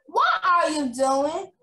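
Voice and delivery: slow, loud scolding question; angry teacher. Acted. Teacher scolding a misbehaving pupil, loud and slow. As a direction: demand an answer, each word slow and loud. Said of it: "shouted Miss".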